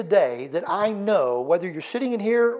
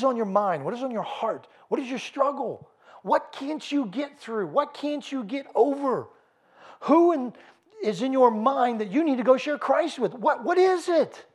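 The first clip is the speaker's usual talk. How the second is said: higher and pleading, building urgency; rising preaching intensity; 7 semitones higher than usual. Acted. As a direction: press them with each question. Preacher presses the congregation.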